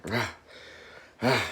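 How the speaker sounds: ordinary delivery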